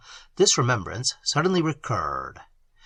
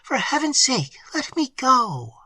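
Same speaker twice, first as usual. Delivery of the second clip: trembling plea; 10 semitones higher than usual. A narrator's reading. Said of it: "said Emily in a trembling voice".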